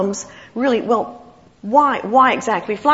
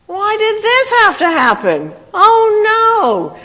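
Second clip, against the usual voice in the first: high, loud mock wail of despair; dismayed wail; 12 semitones higher, 7 dB louder, slower than usual. Preacher acts out waking to a new challenge, wailing in dismay. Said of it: "and you're so discouraged again".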